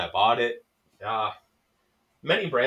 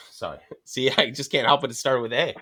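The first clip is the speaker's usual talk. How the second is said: usual speaking voice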